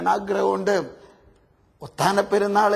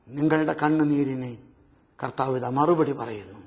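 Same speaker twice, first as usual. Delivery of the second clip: gentle reassurance; 7 semitones lower than usual. Real, not acted. Soft consoling lines.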